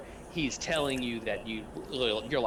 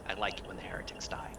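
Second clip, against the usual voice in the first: creepy whisper; 3 semitones lower, 7 dB quieter than usual. Acted. Zealous doctor gloats softly.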